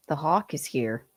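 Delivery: usual speaking voice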